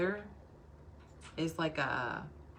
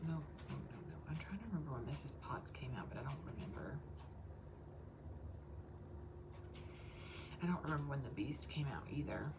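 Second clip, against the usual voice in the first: whispering; 3 semitones lower, 12 dB quieter, faster than usual. Real, not acted. Consultant whispering to her live viewers while her child sleeps, hushed whisper. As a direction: breathe the words, no voice at all.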